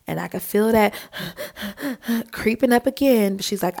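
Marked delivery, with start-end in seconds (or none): 1.0-2.3 soft, quick panting puffs; panicked breathing. Retold or imitated. Floating swimmer feeling panic return, imitated shaky panting.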